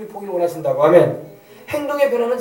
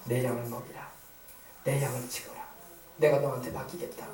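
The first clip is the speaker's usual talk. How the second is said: low, soft, reassuring charge; gentle; 9 semitones lower, 11 dB quieter than usual. Acted. Jesus entrusting his sheep to Peter, gentle. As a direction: entrust it quietly, with calm tenderness.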